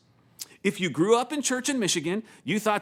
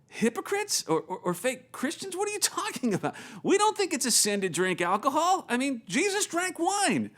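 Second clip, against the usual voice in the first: high-pitched retold disbelief; incredulous protest; 8 semitones higher than usual. Pastor answering elder's hypocrite charge, incredulous protest.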